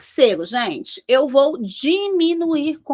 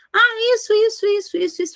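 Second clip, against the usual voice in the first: high-pitched, dismissive rattle of reasons; mock listing of complaints; 8 semitones higher than usual. Consultant retelling a client's reasons for firing someone, mock listing. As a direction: imitate a client reeling off reasons, voice lifted.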